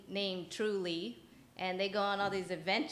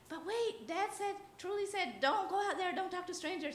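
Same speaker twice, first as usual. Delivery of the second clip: put-on little girl's voice; 11 semitones higher than usual. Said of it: "and the girl says".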